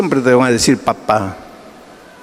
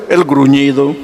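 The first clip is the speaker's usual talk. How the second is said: ordinary talk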